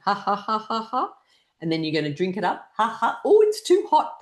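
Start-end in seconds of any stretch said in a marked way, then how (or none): 0.0-1.1 higher ha-has climbing steadily; short rising practice laugh; exercise laughter. Shown as a demonstration. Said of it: "Make sure you laugh with every activity". 2.8-3.2 two higher deliberate ha-has; quick practice laugh while miming; short put-on laugh; exercise laughter. Shown as a demonstration. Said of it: "Make sure you laugh with every activity". 3.2-4.2 loud, high mock alarm; playful high-pitched yelp of words; pretend too hot. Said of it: "Pretend it's too hot".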